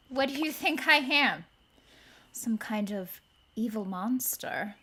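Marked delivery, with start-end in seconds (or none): 2.3-4.7 mock whisper. Mock-innocent aside as villain queen.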